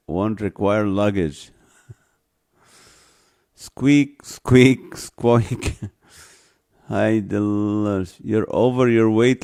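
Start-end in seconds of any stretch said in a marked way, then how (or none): 3.6-5.8 mock squeak of a shoe, laughing; squeaky shoe noise. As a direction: squeak like a worn sneaker.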